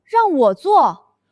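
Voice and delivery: slow, stunned question; shocked disbelief. Acted. Assistant stunned at being handed the doomed project. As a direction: drag it out in disbelief.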